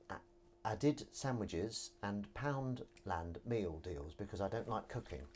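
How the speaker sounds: ordinary delivery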